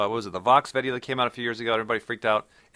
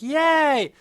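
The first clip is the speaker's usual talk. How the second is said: squealed high, loud "Yay!"; gleeful cheer; 5 dB louder than usual. Retold or imitated. Crowd mocked as thrilled volunteers, high excited squeal. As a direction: cheer like an eager test subject. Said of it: "They're like".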